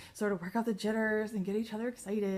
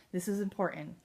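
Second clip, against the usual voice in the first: ordinary delivery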